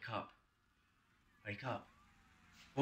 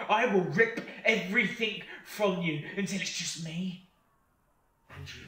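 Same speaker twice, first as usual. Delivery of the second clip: loud, higher snarling threat; menacing threat; 8 semitones higher, 8 dB louder than usual. Acted. Demon threatening Kane, rising menace. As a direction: tear into him with glee.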